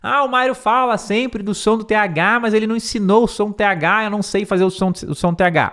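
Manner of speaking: a hint of a fretful student; worried student. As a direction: voice an anxious student. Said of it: "talvez você pense".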